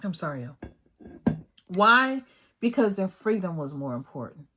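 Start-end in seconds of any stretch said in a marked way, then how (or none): 0.0-1.4 choked up. Real, not acted. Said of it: "I'm getting choked up".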